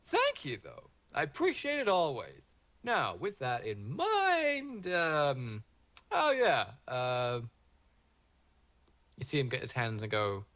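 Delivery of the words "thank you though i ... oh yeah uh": higher, louder, airy voice with lazy drawn-out words; breezy green man. Acted. The green man answering the dryad, airy and offhand. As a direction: brush it off, then turn gracious and grand. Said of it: "And he goes".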